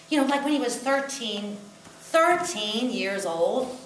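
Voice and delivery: ordinary talk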